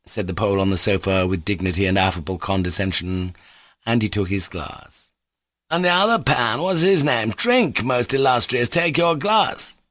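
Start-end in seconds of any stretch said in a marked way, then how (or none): 5.7-9.8 high, coaxing urging; eager host pressing a guest. A narrator's reading. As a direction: press him to drink, pitched up. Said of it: "Mitya urged".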